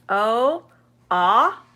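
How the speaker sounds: "O" as its letter name, then "ah" as in hot; long then short. Shown as a demonstration. Teacher contrasting the two O sounds for learners, long then short. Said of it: "long, short".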